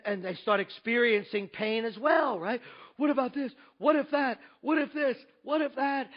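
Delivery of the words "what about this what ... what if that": rising panicky what-ifs, louder and higher; anxious what-ifs. Retold or imitated. Pastor mimicking a worried public, panicky. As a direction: pile up the worries, each one more alarmed.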